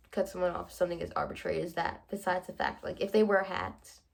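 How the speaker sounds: usual speaking voice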